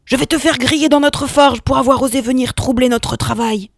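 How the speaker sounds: loud, menacing monster voice; threatening giant. Acted. The Cyclops threatening Hermès, loud and menacing. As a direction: threaten to roast him in the forge.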